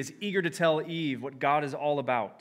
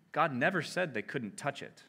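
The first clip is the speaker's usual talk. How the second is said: normal talking voice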